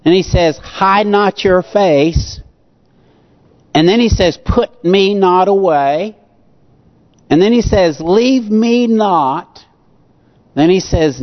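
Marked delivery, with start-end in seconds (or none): 0.6-2.4 slow pleading quote. Acted. Quoting the psalm's pleas. 4.4-6.2 slow pleading quote. Acted. Quoting the psalm's pleas. 8.0-9.7 slow pleading quote. Acted. Quoting the psalm's pleas.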